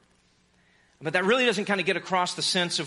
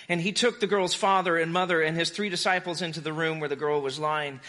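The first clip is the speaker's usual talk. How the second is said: ordinary delivery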